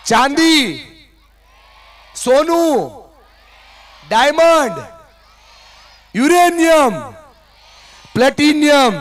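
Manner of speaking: loud, high, drawn-out call of precious metals; rising excitement. Acted. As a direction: build the list up like an auctioneer. Listing ever costlier fillings.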